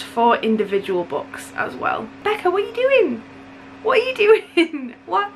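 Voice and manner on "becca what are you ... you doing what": very high, incredulous mock scolding; mock disbelief. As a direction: squeal it in overwhelmed disbelief at a friend's generosity. Mock scolding a generous friend.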